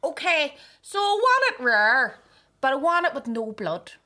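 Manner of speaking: high, loud Ballymena customer demanding; Ballymena accent. Retold or imitated. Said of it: "she went".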